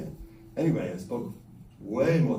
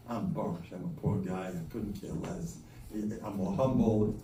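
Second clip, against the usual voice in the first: humble mumble; 5 semitones lower, 6 dB quieter than usual. Retold or imitated. Poor man voiced as humble.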